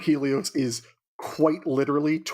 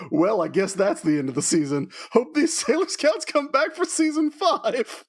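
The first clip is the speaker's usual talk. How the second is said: lightly voiced villains signing off, pitch climbing high by the end; mock farewell; 7 semitones higher than usual. Amazon quartet signing off at the season's end, mock farewell.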